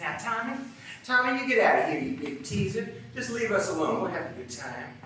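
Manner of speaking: high-pitched woman shooing a man off; Dixie's coaxing rebuke. Acted. Dixie shooing father out, coaxing rebuke.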